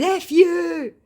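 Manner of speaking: high loud dismissive whatever; sulky scammer. Acted. Scammer brushing her off, high loud mock voice.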